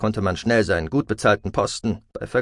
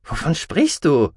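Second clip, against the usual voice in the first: higher, drawn-out puzzled question; irritated confusion; 6 semitones higher than usual. A narrator's reading. Sister questioning her brother, mildly irritated confusion. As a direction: ask it slowly, a touch irritated. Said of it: "fragte Kasadi irritiert".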